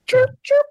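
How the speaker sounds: high chirpy bird imitation; bird chirps. Joking after a bird haiku, loud high bird chirp.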